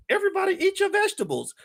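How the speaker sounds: high, quick puppet voice. Pretend. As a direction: squeak it out like a puppet on a hand. Voicing the puppet's line.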